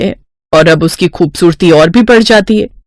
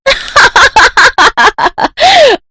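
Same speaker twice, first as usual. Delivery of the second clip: high, prolonged cackling laugh; ha-ha-ha in a high, taunting run; mocking laughter. A narrator's reading. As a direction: laugh long and high at someone's humiliation. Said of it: "उस पर खूब हंसने लगती है".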